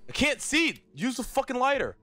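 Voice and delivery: high, frantic complaint; panicked. Real, not acted. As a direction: blurt it out, scrambling in the dark. Panicking in the dark.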